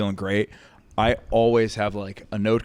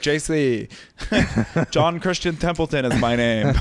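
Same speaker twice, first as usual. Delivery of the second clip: higher-pitched, playful camp imitation; flamboyant heckler; 4 semitones higher than usual. Drunk heckler introducing himself to the host, flamboyant imitation. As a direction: announce the full name with mock flamboyance.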